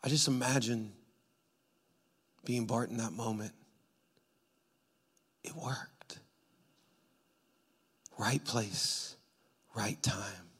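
low, very soft preaching; quiet awe, long pauses between phrases; hushed reflection. Acted. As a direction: let it sink almost to a whisper. Hushed reflection after the climax.

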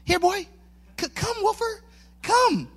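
high, gentle dog-calling voice; sweet-talking a dog; nice-guy coaxing. Retold or imitated. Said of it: "supposed to be a nice guy".

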